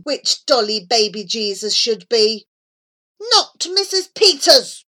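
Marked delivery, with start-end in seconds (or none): 3.2-4.8 put-on high, loud childish protest; indignant. A narrator's reading. Child objects to teacher choosing, loud and indignant. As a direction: punch it out crossly, pitched up.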